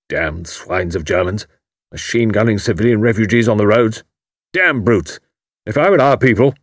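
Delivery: a hint of a gruff old major fuming; blustering major. A narrator's reading. Major reading his paper aloud, fuming. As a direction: grumble aloud at the newspaper. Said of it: "uttering appropriate comments aloud".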